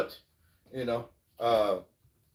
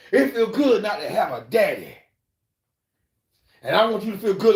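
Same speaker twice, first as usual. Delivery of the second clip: louder, higher mocking taunt; 6 semitones higher, 5 dB louder than usual. [name] taunts [name] while dropping money, loud mocking. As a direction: rub it in. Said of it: "He said".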